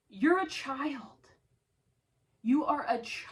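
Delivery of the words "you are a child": contemptuous whisper. Real, not acted. Repeated accusation, then whispered.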